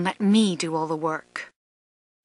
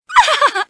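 mocking laugh; 4 dB louder than usual. Taunting laugh after a hit.

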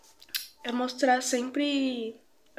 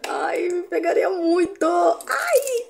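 flirty squeal; 5 semitones higher, 4 dB louder, much slower than usual. Pretend. Flirting with own reflection.

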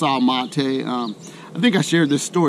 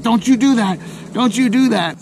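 higher, louder warning hiss of a worried wife; 6 semitones higher, 4 dB louder than usual. Retold or imitated. Wife stops her husband asking strangers, high sharp warning. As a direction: pitch it up and warn him off sharply. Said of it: "my wife was like".

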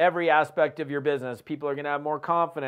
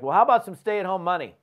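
imitating a quirky pitch, louder and higher; playful, cheeky offer with a pitched-up lift; quirky and funny; 4 semitones higher, 5 dB louder than usual. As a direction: say it like a goofy sales line. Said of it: "quirky and funny".

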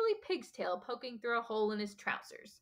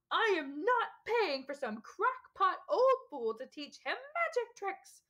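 high, slow, strangled yell; a furious uncle losing control, pitch shooting up; 9 semitones higher, slower than usual. A narrator's reading. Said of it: "yelled Uncle Vernon".

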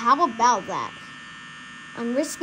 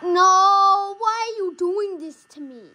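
louder, higher outraged no; dismayed cry; 6 semitones higher, 4 dB louder than usual. Real, not acted. Player caught by the animatronic, dismayed cry. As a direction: wail at the unfair game. Jumpscared at night end.

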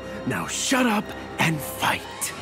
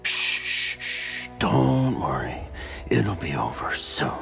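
menacing hush, sinking much lower; mock soothing; 9 semitones lower than usual. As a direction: shush the victim like a child. Mock-soothing an opponent about to die.

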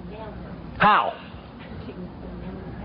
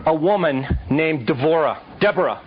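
normal talking voice

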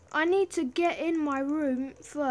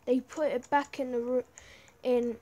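normal talking voice

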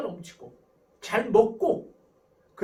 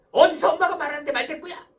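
high, loud mock telling-off; exasperated parent with raised voice; scolding mother; 6 semitones higher, 10 dB louder than usual. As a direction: act a mother challenging her child angrily. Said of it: "또 혼냅니다".

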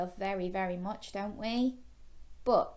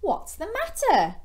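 high, loud questioning character voice; concerned young shark; 10 semitones higher, 6 dB louder than usual. Acted. Gilbert to a crying fish, high-voiced concern. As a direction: ask with storybook concern, pitched up high.

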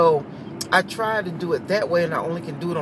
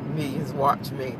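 ordinary talk

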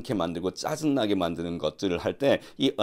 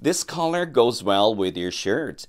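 slow, careful model sentence; slow model reading; 3 dB louder, much slower than usual. Shown as a demonstration. Teacher modelling English slowly for students, slow and careful. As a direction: read it out slowly for learners to copy.

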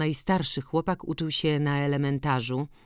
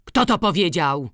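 loud, raised demand; loud cry; 4 semitones higher, 7 dB louder than usual. A narrator's reading. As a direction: call out sharply, demanding an answer. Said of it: "zawołał głośno". (głośny okrzyk)

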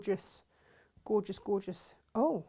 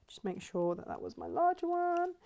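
high musing; 9 semitones higher than usual. Real, not acted. Checking to herself.

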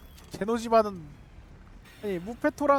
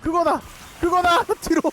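panicked urgent shouting; 9 semitones higher, 6 dB louder than usual. Real, not acted.

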